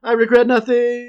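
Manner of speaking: high, loud, falling-man cry; loud defiant yell. Retold or imitated. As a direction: belt it out like falling. Quoting man falling off bridge.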